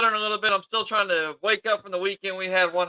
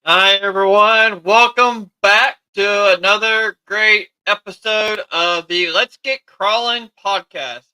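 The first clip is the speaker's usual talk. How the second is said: show host intro; 4 semitones higher, 8 dB louder, slower than usual. Acted. Podcast host opening greeting.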